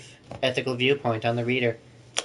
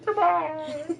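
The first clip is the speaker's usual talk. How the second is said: high character voice. Acted. High goodbye, game voice.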